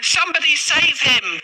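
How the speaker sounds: frantic high wail for help; wailing cry for help. A narrator's reading. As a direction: cry out as if your master is drowning. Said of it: "Puss wailed".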